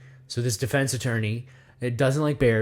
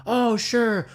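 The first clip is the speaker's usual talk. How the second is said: good cop; 9 semitones higher than usual. Acted. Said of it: "they're playing good cop".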